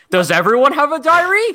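high, loud incredulous question; mock disbelief. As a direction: exclaim it in exaggerated disbelief. Mock outrage at repeated diary plot.

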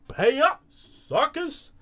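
high-pitched crowing demand; hammed-up high taunt; gloating winner. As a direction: crow it at the losers, voice jumping up. Acted gloating winner demands payment.

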